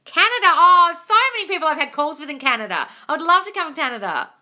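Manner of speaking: eager to visit; excited. Real, not acted. Host excitedly answering a Canadian viewer, high and loud.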